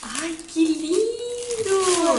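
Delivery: high, slow drawn-out delight; delighted gushing. Real, not acted. Mother delighting at the gift.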